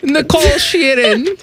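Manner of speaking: high, drawn-out mock German; German accent. [name] addressing his co-host by name, in a German accent. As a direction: say the name slowly as a comic German. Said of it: "when I talk in this".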